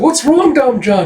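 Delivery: very high, loud cry; alarmed girl. Acted. Erica cries out to Dom John as he screams, very high and loud. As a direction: shriek it out in panic.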